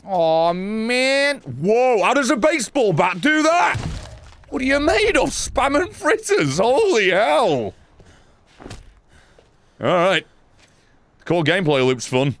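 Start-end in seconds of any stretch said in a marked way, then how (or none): 0.0-7.7 high, drawn-out amazed outcry; astonished. Real, not acted. Gamer amazed by a baseball bat's damage, astonished. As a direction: exclaim in rising disbelief. Amazed at what the bat did.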